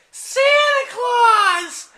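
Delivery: very high, loud, drawn-out shriek; hammed-up squeal, each word stretched long; excited squeal. Wife greeting figure from the chimney, excited squeal.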